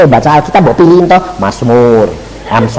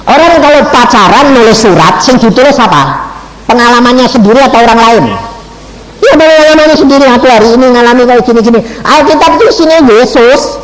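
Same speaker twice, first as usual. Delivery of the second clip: rising preaching; 7 semitones higher than usual. Real, not acted. Preacher raises voice, lively.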